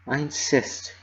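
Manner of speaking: gently pleading, barely audible; timid insistence; insistent whisper. A narrator's reading. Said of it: "she whispered".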